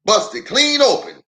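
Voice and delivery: country uncle judges the hose; Uncle Zeke. Acted.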